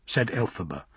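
ordinary delivery

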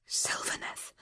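breathless awed whisper; gasped whisper. A narrator's reading. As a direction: gasp the name under your breath. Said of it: "she gasped".

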